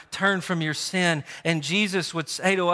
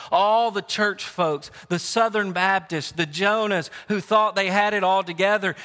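ordinary talk

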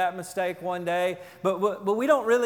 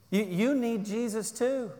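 usual speaking voice

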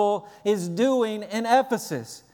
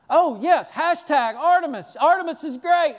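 higher, louder hammed-up cheer; mock crowd cheer; 8 semitones higher, 4 dB louder than usual. Preacher mocking a cheering rioter in the theater, hammed-up excited cheer. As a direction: parody an empty, eager rioter's cheer. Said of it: "Some of them are standing in the theater saying".

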